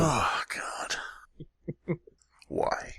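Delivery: whispered, horrified oh god; whispered disgust. Real, not acted. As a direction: mutter it under your breath in disgust. Disgusted aside.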